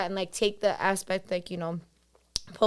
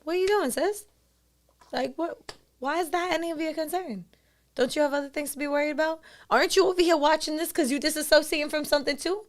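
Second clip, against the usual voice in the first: higher, sassy scolding of yourself; teasing higher-pitched inner scold; sassy self call-out; 7 semitones higher than usual. Retold or imitated. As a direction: call yourself out like a nosy sister. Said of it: "I'm gonna be like".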